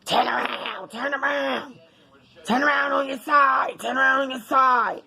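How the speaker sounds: hollering at her to roll over; husband barking orders at night, hammed up; imitating husband yelling. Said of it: "another thing he'll do is".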